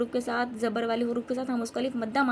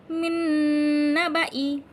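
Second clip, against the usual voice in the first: long held "मिन", louder chant; recitation demo; 3 semitones higher, 8 dB louder, slower than usual. Shown as a demonstration. Teacher reading the word without the alif, slow chanting. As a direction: recite slowly in drawn-out syllables. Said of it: "लेकिन किस तरह से पढ़ेंगे?".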